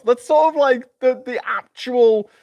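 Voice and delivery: high, squeaky voice of disbelief; incredulous rant. Real, not acted. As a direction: push it up in exasperation.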